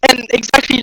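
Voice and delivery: usual speaking voice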